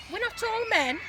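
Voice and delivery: put-on girl's voice. A narrator's reading. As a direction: pipe up as the girl correcting dad. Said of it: "said Laura".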